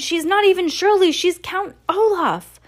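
put-on high, loud child's outburst; outraged cry. A narrator's reading. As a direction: cry it out in high-pitched protest. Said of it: "she cried".

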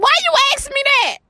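high, wary mock-suspicious question; high suspicious mom. Mom answering her daughter, high and wary. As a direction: squeak it out like a mom who knows something. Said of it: "I was like".

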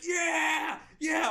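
high, drawn-out mock scream of joy; ecstatic screaming. New hire running down the street, imitating joyful screams. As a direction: whoop like a madman running home. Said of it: "I was screaming down the street like a madman".

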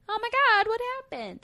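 high, loud mock panicked mom; alarmed mom. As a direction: squeal it like a startled mom. Boyfriend's mom reacting, retold.

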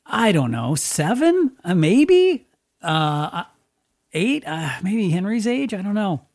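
lightly voiced little girl, high and halting; a hint of a hesitant little girl; kid sister. Little sister unsure of her age, lightly mocked. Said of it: "And Lee's like".

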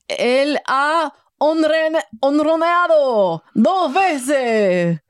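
slow drawn-out Spanish announcer call; Spanish sports commentary. Shown as a demonstration. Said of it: "it's a verb".

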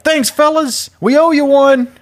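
high, loud cheeky farewell. Acted. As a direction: call out brightly to the cops while leaving. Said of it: "Sirius is like".

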